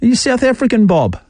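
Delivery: high, teasing sing-song aimed at Bob; mocking sing-song. Radio host taunting texter Bob, high mocking lilt. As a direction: needle him with a high singsong.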